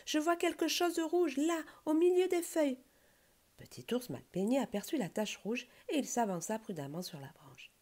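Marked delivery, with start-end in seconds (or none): 0.1-2.8 high-pitched eager pointing-out; excited cry. A narrator's reading. Rabbit spotting something red among the leaves, excited cry. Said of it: "s'écria un des lapins".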